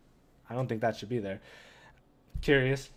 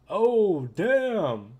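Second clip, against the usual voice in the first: higher, louder gasp of surprise; surprised; 7 semitones higher, 6 dB louder than usual. Real, not acted. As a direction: caught off guard. Surprise at the comic twist.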